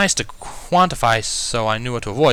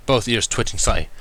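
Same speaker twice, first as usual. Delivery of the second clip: plain reading voice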